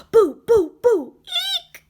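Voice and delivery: very high, frightened squeak; squeaking bat. Acted. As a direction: squeak it out scared. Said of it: "squeaked the bat".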